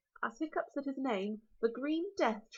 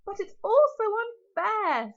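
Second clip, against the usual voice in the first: louder, higher, slow protest; upset protest; 9 semitones higher, 9 dB louder, slower than usual. Acted. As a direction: cry out at the injustice. Hiccup protests, loud, high.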